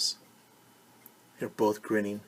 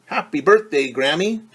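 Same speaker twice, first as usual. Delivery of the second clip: louder and higher, beaming; happy birthday cheer; 5 semitones higher, 9 dB louder than usual. A narrator's reading. Rosa cheering for Grammy, loud and beaming. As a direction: grin through the words. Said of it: "Rosa grinned".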